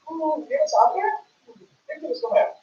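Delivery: ordinary talk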